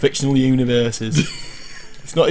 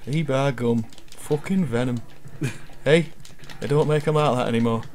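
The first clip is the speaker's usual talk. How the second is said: put-on northern accent; 7 dB quieter than usual. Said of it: "it's going northern".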